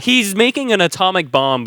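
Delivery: usual speaking voice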